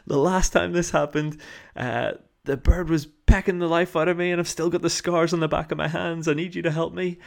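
high, loud urgent plea; old lady pleading. Retold or imitated. Older lady urging the walkers to help, high loud plea. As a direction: beg for help with the bird. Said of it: "She, she said".

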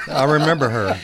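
loud group laughter; laughing. Real, not acted.

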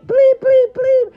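high, loud beep sound over a cuss word; spoken censor beeps; self-bleep. Acted. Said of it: "I can bleep myself".

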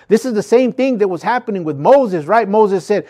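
usual speaking voice

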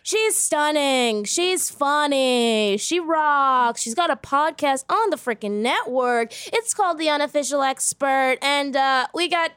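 high, drawn-out show introduction; hype announcer. Acted. Host introducing the podcast guest, hype announcer. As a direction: build up the guest like an emcee.

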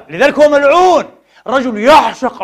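loud, high verdict; condemning shout. Real, not acted. Preacher condemning the man who loves power, loud condemnation. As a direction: pronounce it forcefully as a condemnation.

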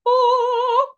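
a very high, loud, drawn-out gasp of wonder; jaw-drop sound at first sight of the hot rods; awestruck gasp. Retold or imitated. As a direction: gasp as if seeing treasure. Awestruck moment in the shed.

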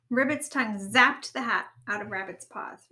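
usual speaking voice

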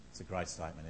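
ordinary delivery

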